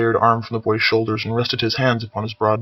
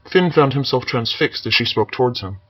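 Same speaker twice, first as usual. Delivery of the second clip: ordinary delivery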